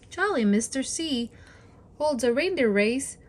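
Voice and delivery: plain reading voice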